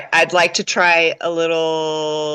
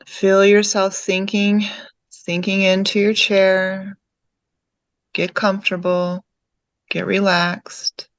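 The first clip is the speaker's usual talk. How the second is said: calm meditation guide, lower than chatting, with long pauses; guided meditation; 4 semitones lower than usual. Real, not acted. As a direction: guide them gently inward, leaving long silences between phrases.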